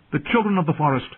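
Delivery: high, eager explanation; blurted. A narrator's reading. Bran to the men at table, blurted. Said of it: "he blurted".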